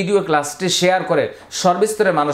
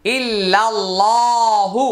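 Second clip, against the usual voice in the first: slow recitation; 3 semitones higher, much slower than usual. Shown as a demonstration. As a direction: recite ইল্লাল্লাহু in a long melodic chant. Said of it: "হু তাড়াতাড়ি". (ধীরে, উঁচু সুরে তিলাওয়াত)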